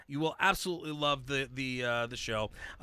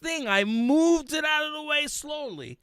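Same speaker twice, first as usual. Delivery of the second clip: suspenseful buildup; 11 semitones higher, 4 dB louder than usual. Retold or imitated.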